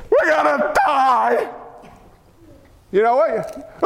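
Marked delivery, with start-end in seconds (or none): 0.1-1.5 slow hammed-up wail of terror; mock panicked cry. Preacher imitating himself panicking at the Red Sea, mock wail. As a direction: wail it like a grown man crying for mommy. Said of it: "I would have cried and fell down and yelled".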